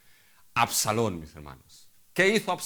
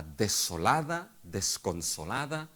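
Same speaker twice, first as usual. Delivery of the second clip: slow; slower than usual. Acted. Preacher drawing out the words.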